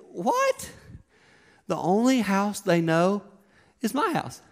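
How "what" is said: put-on a child who hasn't followed a word; confused kid. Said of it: "they're probably just thinking".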